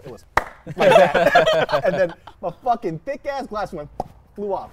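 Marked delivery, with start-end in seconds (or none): 0.8-1.7 high loud mock impact noise; imitating the thud. Imitating his fall to a friend, high loud mock thud. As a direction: act out the sound of the belly hitting the floor. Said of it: "I remember the noise that it made".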